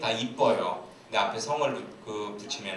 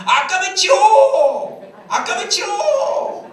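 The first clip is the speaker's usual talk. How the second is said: very high, loud, long-held yell; hammed-up platoon leader screaming a dialect order; shouted command; 12 dB louder, much slower than usual. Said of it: "소대장이 또 이렇게 소리쳤습니다".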